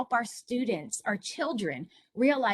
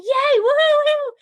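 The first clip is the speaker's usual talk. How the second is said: much higher, much louder joyful whoops; hooting and hollering; 14 dB louder than usual. Retold or imitated. Son bursting in after solving the problem, whooping. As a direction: whoop it like a kid who just cracked it. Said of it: "he came down hooting and hollering".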